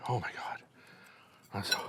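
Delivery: breathless unvoiced whisper; awed whisper. Real, not acted. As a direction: gasp it out in quiet awe. Overwhelmed by the first bite.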